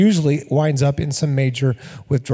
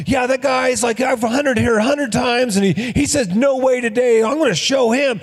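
mock angry rant; 7 semitones higher than usual. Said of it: "He goes".

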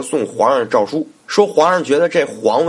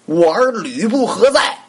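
high, strained call for help; wounded Dong Zhuo crying out for Lü Bu; wounded man calling his adopted son for rescue; high-pitched cry; 9 semitones higher than usual. A narrator's reading. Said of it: "高声叫道".

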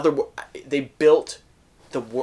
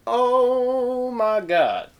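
slow awed gasp; 10 semitones higher, much slower than usual. Acted. Echoed awe at the movie.

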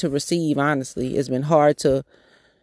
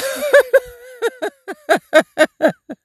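high burst of real laughter; 4 dB louder than usual. Real, not acted. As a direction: let a real laugh break out, loud and high. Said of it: "Like, I'm laughing".

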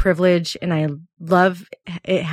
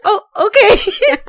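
squeaky teary thanks; 14 semitones higher, 5 dB louder than usual. Retold or imitated. Teary reply retold.

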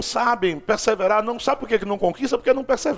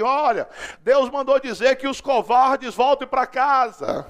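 higher, slow public proclamation; megaphone announcement; 3 semitones higher, slower than usual. Acted. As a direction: proclaim it bluntly to a whole army. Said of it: "pega um megafone e diga assim".

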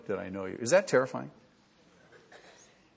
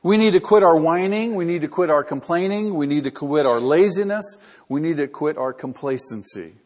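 loud, building pulpit command; fervent exhortation; 4 semitones higher, 8 dB louder than usual. Acted. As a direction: hammer each line harder, then ease. Exhortation at full force.

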